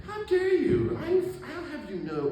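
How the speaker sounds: high, loud mock outrage; mock indignation. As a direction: protest in high-pitched, wounded dignity. Acted indignant retort to Tutu.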